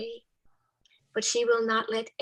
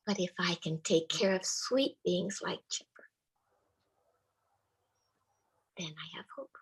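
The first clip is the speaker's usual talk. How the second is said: whispered, softening further to the end; hushed confession; 3 semitones lower, 4 dB quieter than usual. Retold or imitated. Teenage girl confiding her hope to the chaplain, whispered. Teen girl's hopeful confession.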